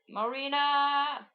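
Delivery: high, drawn-out slow call of her name; slow, high happy reunion call; joyful call. Acted. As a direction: stretch the name in joy. Edward calls out to Marina.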